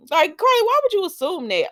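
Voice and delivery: high, loud incredulous challenge; indignant. Acted. Viewer scolding Carly for assuming, indignant. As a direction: call her out in mock outrage.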